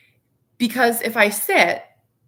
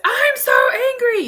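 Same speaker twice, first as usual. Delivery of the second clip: high, loud roaring giant; angry giant shout; 7 dB louder than usual. Retold or imitated. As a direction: bellow it like a cartoon giant. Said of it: "when the giant says".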